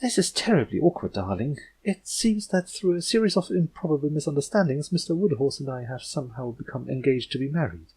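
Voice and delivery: a mock-posh confidential aside, darling; Rarity whispering. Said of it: "leaned in to whisper to her".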